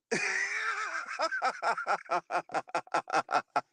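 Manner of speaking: high laugh stuttering down, long and helpless; long real cackle from a high start; high cackling laugh. Real, not acted. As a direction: lose it laughing. Laughing at the allegation.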